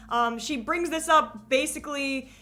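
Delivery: normal talking voice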